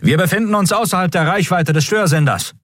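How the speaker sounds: high, formal announcement; announcing officer. A narrator's reading. Communications officer announcing to the crew, high and formal. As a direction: announce it to the bridge. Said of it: "verkündete der Kommunikationsoffizier".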